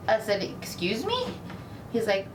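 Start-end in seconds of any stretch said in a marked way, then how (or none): 0.4-1.3 high, stunned excuse me; shocked reply to terrible news; shocked disbelief. Retold or imitated. Retold: hearing her mom died.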